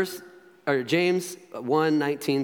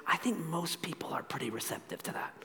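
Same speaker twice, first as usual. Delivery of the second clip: hushed whisper; 13 dB quieter than usual. Real, not acted. Preacher confiding to congregation about receptive people, whispered. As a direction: drop right down into a whispered aside.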